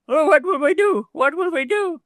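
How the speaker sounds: frightened frogs. Acted. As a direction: cry out like scared frogs.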